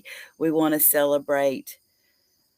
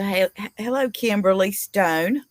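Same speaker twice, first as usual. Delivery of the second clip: normal talking voice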